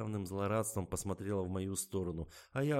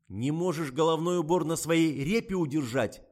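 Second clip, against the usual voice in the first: attacking; 10 semitones higher, 12 dB louder than usual. A narrator's reading. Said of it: "налетел Николай на Катю".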